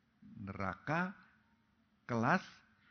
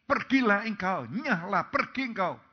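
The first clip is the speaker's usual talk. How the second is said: louder, higher, driving them out; God sending the wicked away; loud banishing command; 4 semitones higher, 6 dB louder than usual. Acted. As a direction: shout each dismissal. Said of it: "Tuhan berkata".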